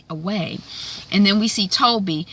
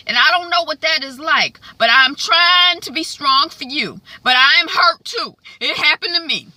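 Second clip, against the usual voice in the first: hurt earnest husband; 7 semitones higher than usual. Retold or imitated. Husband's emotional speech.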